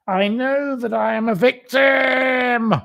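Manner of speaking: high, slow, shouted self-pity; mock victim shout. Mocking her victim claim to viewers, slow high shout. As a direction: wail it out like an outraged victim.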